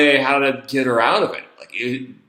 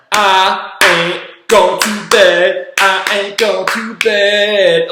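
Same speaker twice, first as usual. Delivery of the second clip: slow, loud, higher-pitched taunting chant; mocking defiance; 6 semitones higher, 6 dB louder, much slower than usual. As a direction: chant it word by word, like a defiant kid clapping along. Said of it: "mocking me in my face".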